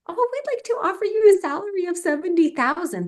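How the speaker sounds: high, bright, casual offer voice; breezy company voice. Retold or imitated. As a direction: play the recruiter offering it without blinking.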